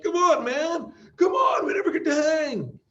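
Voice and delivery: high, loud whining plea; whiny buddy. Retold or imitated. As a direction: beg like a needy friend. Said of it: "your buddy's like".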